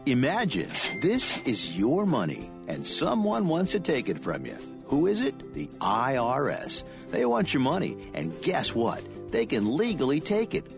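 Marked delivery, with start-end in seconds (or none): none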